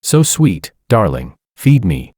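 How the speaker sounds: usual speaking voice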